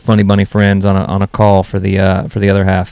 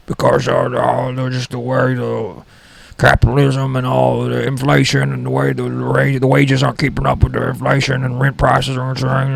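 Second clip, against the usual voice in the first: put-on crazy old guy; 3 semitones higher than usual. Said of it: "I live with this crazy old guy".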